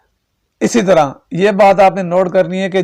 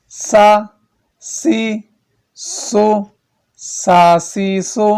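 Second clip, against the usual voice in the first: slow, drawn-out chant of each letter and vowel; qaida recitation; 3 semitones higher, much slower than usual. Shown as a demonstration. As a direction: recite the drill, stretching every syllable. Syllable drill recitation.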